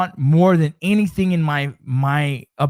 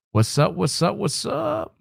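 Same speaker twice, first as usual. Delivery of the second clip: rising greeting; 6 semitones higher than usual. Real, not acted.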